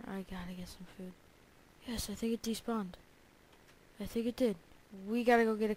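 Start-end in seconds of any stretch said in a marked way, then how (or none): 0.1-1.1 fast whispered worry; urgent whisper. Real, not acted. Gamer whispering about his hunger bar. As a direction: whisper it quick, hungry and worried.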